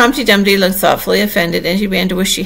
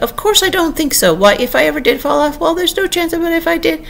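indignant, high-pitched growl of protest; 11 semitones higher than usual. A narrator's reading. Humpty Dumpty dismissing any chance of falling, growled out. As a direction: voice climbing high in testy dismissal. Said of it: "Humpty Dumpty growled out".

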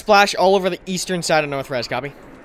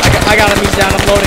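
excited callout; 3 semitones higher, 7 dB louder, faster than usual. Real, not acted. Calling the kill in combat.

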